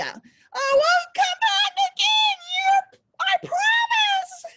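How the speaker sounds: high, loud, slow crying shout; desperate promise. Acted. Dragged devil shouts his promise to John, high and crying.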